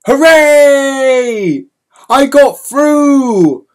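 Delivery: high, loud cry of triumph; triumphant cheer. Retold or imitated. Boy cheering to fellow smugglers, high and triumphant. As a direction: cheer it out, stretched and slow.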